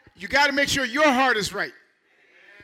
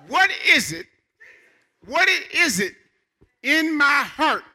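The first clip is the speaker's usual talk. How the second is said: loud and high, each phrase hammered out; slow on in my heart, nearly shouted; preached out loud; 4 semitones higher, 4 dB louder, slower than usual. Real, not acted. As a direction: thunder the question from the pulpit. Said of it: "I have to ask myself".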